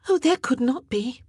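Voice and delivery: relieved sister answering brightly before leaving; happier. A narrator's reading. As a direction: brush off worry brightly. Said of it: "sounding happier".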